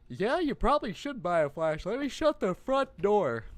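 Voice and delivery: high loud mocking voice; mock the character loudly; sarcastic.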